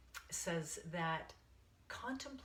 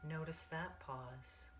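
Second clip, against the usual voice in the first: guided meditation. Acted. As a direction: ease them into the breath, one count at a time.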